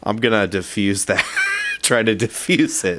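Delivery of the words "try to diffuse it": laughing. Real, not acted. Laughing through the move.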